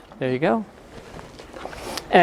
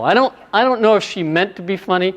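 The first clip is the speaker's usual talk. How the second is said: normal talking voice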